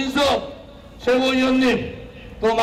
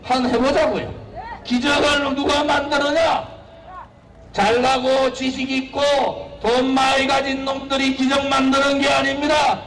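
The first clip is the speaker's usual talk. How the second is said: normal talking voice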